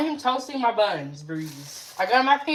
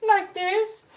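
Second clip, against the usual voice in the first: higher, teasing repeat; playful echo; 10 semitones higher than usual. Real, not acted. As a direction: throw his words back at him, playfully. Teasing repeat of friend's line.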